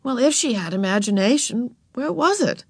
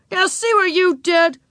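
put-on high, indignant boy's voice; accusing; 11 semitones higher, 4 dB louder than usual. A narrator's reading. Wayne blames Ramona for the spilled paint, accusing. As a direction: blame the other child for the mess.

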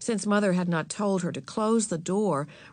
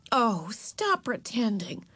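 slow, higher, fed-up sisterly voice; exasperated; 4 semitones higher, slower than usual. A narrator's reading. Beezus to her little sister, exasperated. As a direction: drag it out with exasperation. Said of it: "Beezus was exasperated with Ramona".

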